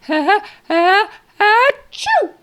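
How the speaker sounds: loud, high, hammed-up build to a sneeze; fake sneeze. Shown as a demonstration. Therapist faking a big sneeze for a toddler, hammed-up. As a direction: ham up a sneeze for a baby. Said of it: "And I go".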